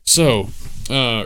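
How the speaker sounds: normal talking voice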